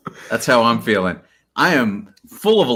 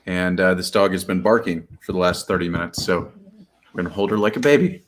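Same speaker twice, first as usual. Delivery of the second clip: ordinary talk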